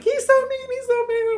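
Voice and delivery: put-on high, loud whiny child's voice; crying little boy. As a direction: imitate a five-year-old sobbing to mom. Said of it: "And I was crying".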